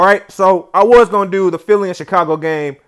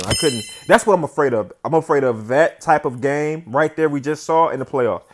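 ordinary delivery

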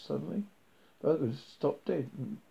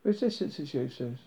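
ordinary delivery, nothing marked

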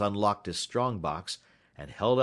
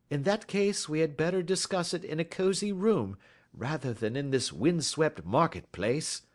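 higher-pitched gentlemanly sleuth; courteous, assured detective; 8 semitones higher than usual. Acted. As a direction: propose it politely, pitched up. Voices Holmes.

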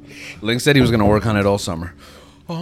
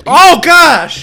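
high loud yelp from the heat; 15 dB louder than usual. Real, not acted. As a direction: yelp as the spice hits. Spicy ramen first bite.

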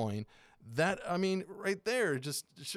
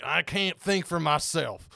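slow, louder mock voter; mocking imitation; 3 semitones higher, 6 dB louder, slower than usual. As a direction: drag it out in mockery of blind followers. Mocking the yard-sign voters.

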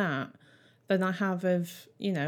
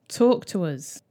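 ordinary talk, nothing marked

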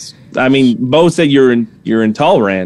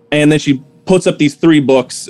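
ordinary talk, nothing marked

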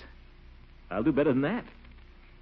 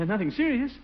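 alarmed question; 13 semitones higher than usual. Acted. Worried, high-pitched question.

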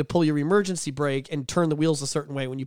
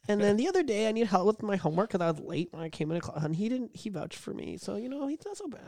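mock coworker softening; 5 semitones higher than usual. Mocking Jessica warming up.

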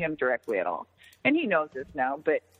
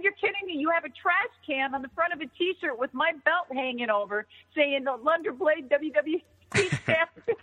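high, amazed retelling; incredulous; 7 semitones higher than usual. Retold or imitated. Wrestler seeing her trash can moment on a shirt, incredulous. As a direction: pitch it high in gleeful disbelief.

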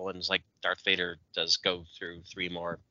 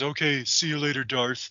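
mocking Obi-Wan; 3 semitones higher than usual.

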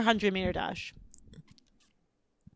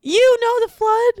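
excited high squeal; 13 semitones higher, 7 dB louder, slower than usual. Real, not acted. Excited disbelief.

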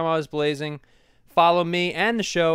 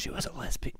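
imitated whisper; 3 semitones lower, 11 dB quieter than usual. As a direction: hush it like gossip. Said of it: "like, whispered".

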